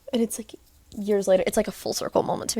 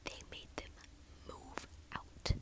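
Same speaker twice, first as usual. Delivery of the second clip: dramatic whisper; hushed reveal; 25 dB quieter than usual. Real, not acted. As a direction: whisper it like a scandal.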